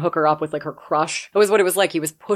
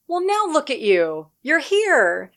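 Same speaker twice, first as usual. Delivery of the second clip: delighted welcome; 8 semitones higher, slower than usual. Retold or imitated. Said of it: "he throws his arms up in the air".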